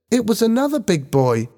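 high-pitched schoolboy voice; young boy. A narrator's reading. Ben tells older students what happened, young boy.

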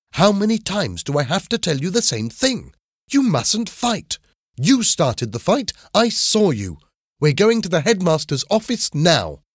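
high-pitched, exasperated telling-off; severe scolding. A narrator's reading. Teacher tells Willie off for starting the fight, severe scolding. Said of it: "looked at him severely".

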